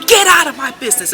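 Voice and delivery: loud, high retold order; imitated strong voice. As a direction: act out a strong-voiced manager throwing someone out. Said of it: "her voice was so, like, strong".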